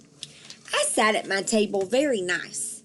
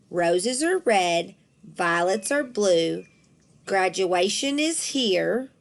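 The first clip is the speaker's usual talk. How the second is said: slow, drawn-out recital; reading aloud slowly; slower than usual. A narrator's reading. Teacher reading the class poem aloud, slow recital. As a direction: read each line out like a poem. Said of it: "She read the first three lines out loud".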